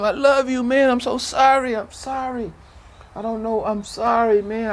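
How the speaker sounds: high, loud anguished cry of apology; screaming to him. Retold or imitated. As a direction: plead loudly to the dying boy. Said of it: "I remember screaming to him".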